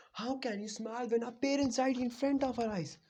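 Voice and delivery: high, loud furious yell at his friend; angry shout. Retold or imitated. Sasuke, after punching Naruto, shouts at him in anger. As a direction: yell the accusation, voice cracking high.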